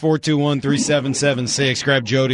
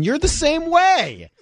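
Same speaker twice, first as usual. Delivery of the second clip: excited; much slower than usual. Real, not acted. Teasing accusation at Lydia.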